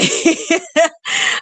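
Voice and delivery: high giggling laugh; helpless laugh. Real, not acted. Laughing mid-sentence at own state.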